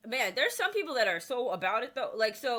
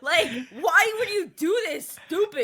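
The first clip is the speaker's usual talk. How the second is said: higher mock angry rant; mock berating; 7 semitones higher than usual. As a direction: scold the clumsy player in jest. Mock berating the bell player.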